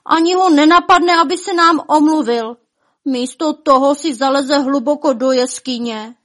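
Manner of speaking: high, loud complaining wail; loud lament. A narrator's reading. Elves resenting the dragon's missing apology, loud lament. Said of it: "naříkat ještě hlasitěji".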